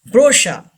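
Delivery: brochure as "BRO-sha", no R at the end; brochure, stressed first, ending in "sha"; silent final R. Shown as a demonstration. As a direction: say brochure with CH as "sh" and drop the last R. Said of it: "R is not pronounced".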